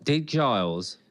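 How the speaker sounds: ordinary talk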